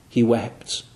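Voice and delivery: soft lingering echo of the first take; hushed echo. Acted. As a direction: let the repeat fall away quietly. Softer echo of repeat.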